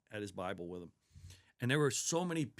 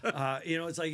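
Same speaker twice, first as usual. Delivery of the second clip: laughing; 7 semitones higher, 8 dB louder than usual. Real, not acted. Laughing after host's joke.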